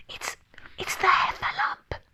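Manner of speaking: frightened, halting whisper; hushed and fearful; nervous. A narrator's reading. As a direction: whisper it as a scared, stammering thought. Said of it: "thought Piglet nervously".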